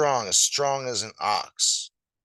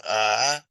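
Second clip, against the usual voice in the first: lion gulp; 6 dB louder than usual. Acted. Lion eating an ox.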